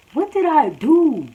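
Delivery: high, stretched-out question; baffled protest. Retold or imitated. Student answers amazed classmates, baffled protest.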